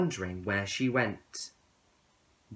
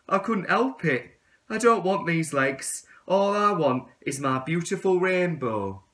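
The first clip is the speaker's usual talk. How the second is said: higher, louder pleading; begging tadpole; 6 semitones higher, 7 dB louder than usual. Acted. Tadpole begging the caterpillar for forgiveness, higher and louder. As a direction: plead for another chance. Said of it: "begged the tadpole".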